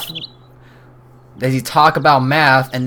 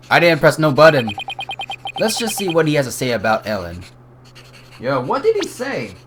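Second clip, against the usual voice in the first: ordinary talk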